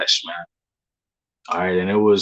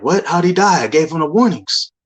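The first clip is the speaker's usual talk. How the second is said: shocked disbelief; 7 semitones higher than usual. Retold or imitated. Hearing in a dream that his brother died, shocked and high. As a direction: recoil at news you half expected.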